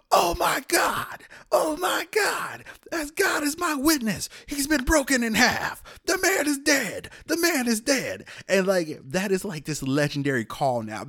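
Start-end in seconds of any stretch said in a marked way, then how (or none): 0.1-8.3 high, anguished announcer shout, stretched out; Jim Ross. Retold or imitated. Wrestling announcer calling a horrifying fall, high horrified cry. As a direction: cry out in horrified disbelief, drawn-out words. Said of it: "and Jim Ross says, he goes".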